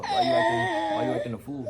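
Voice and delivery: mock-scolding parent voice, rising high and loud; hammed-up parents yelling at a misbehaving son. Parents asking their son why he acts up, in a mock-scolding voice. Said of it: "They were just like".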